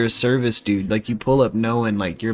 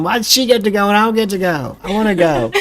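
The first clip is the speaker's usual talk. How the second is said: whiny Southern sibling; 11 semitones higher, faster than usual. Retold or imitated. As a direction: whine like the left-out younger sibling. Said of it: "I've been that sibling".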